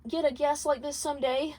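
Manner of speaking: light, high, lively princess voice; Charlie's voice. A narrator's reading.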